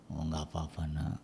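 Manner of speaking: soft, indifferent shrug of a reply; a mild, soft-spoken lenient father; too soft father. Retold or imitated. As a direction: brush it off like a lax father. Father shrugs off drunk child.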